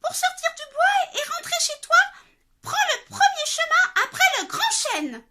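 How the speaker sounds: very high, loud squeaky cub; high little bear giving directions; tiny bear explaining. Acted. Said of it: "de sa toute petite voix".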